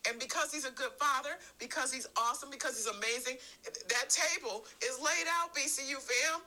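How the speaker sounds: climbing into full preaching voice; rising sermon fervor. Acted. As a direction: lift the congregation toward the table.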